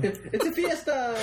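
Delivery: high, slow, laughing fiesta shout; laughing party cry. Pretend. Mock Mexican party cry.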